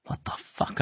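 breathless stunned whisper; shocked whisper. Acted. Narrator stunned by Wajim, whispering. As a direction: mutter it under your breath, alarmed.

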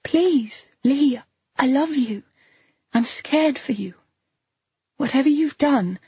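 high, pleading, voice catching; catch in her voice. A narrator's reading. Claire pleading with Lee, voice catching. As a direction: beg him with a catch in the throat. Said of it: "there's a catch in her voice".

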